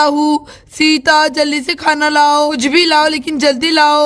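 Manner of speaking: whining hungry husband.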